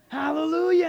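high, loud hallelujah; jubilant praise. Acted. Preacher acts David praising the Lord, jubilant shout. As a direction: cry out in acted jubilation.

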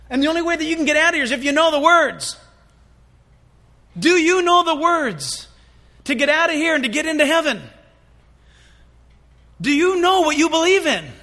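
loud, high, driving sermon voice; preaching at full force. Acted. Preacher pressing the crowd at the locked door, loud and urgent. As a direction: press the question on the congregation.

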